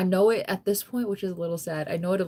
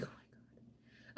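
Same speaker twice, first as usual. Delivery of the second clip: whisper; 29 dB quieter, much slower than usual. Retold or imitated. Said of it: "I'm like".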